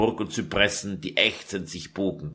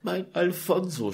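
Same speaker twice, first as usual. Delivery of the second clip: sobbing; 7 semitones higher than usual. Acted. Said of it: "schluchzte Lucretia".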